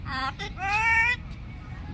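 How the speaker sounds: a bird echoing the slow words; parrot mimic. Real, not acted. Parrot echoing the owner's slow birthday greeting.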